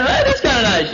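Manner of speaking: high, put-on admiring voice; mock goofy interest; doltish Adam. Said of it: "He was looking at it".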